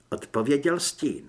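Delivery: usual speaking voice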